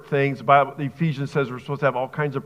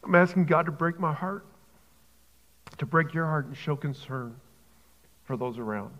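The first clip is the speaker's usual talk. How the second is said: real choked-up emotion, halting with long pauses; emotional, choked up. Real, not acted. Preacher pleading for suffering churches, choked up. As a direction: fight back tears, leaving long silences between phrases. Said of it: "Sorry for getting emotional".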